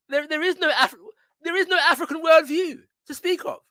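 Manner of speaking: high, mocking voice of the doubters; mocking the critics. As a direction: parrot the critics' claim in a high sneer. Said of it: "challenged by those who claim there is no African worldview".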